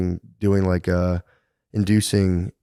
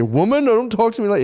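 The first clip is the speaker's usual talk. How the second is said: high, mock-stern husband voice; husband's rebuke; 13 semitones higher than usual. Husband scolding his wife, high mock stern. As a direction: bark it like a pompous husband. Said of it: "You say".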